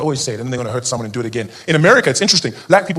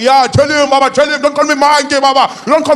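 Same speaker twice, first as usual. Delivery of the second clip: egging friend; 11 semitones higher, 6 dB louder than usual. Retold or imitated. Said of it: "He's like".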